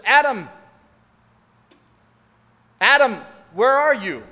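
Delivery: loud, high cry of a grieving father calling out; broken-hearted calling. Retold or imitated. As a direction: call out for Adam like a father who has lost his child. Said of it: "voice of a broken-hearted father".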